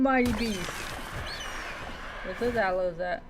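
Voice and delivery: normal talking voice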